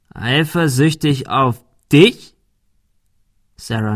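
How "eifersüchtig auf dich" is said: slow, drawn-out scornful question; mock her as if the idea is absurd; bully slowly sneers at the cornered girl; sneering mockery. Thug mocks her claim.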